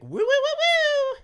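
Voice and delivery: very high, loud wooing; classic Joey woo. Acted. Host demonstrating the caller's catchphrase, high whoops. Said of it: "give us a classic".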